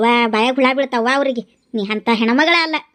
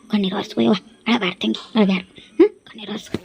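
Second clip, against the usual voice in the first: soothing comfort; 4 semitones lower, 3 dB quieter, faster than usual. Acted. Consoling the crying woman.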